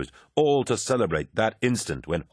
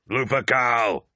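raised, higher war shout; yelled battle cry; 5 semitones higher, 3 dB louder, slower than usual. A narrator's reading. Loken yells his commander's name charging in, raised. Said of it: "Loken yelled".

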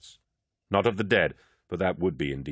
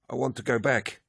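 higher-pitched, uneasy plea; mildly upset; 6 semitones higher than usual. A narrator's reading. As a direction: plead with a touch of distress, pitched up. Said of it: "He was shaking and upset".

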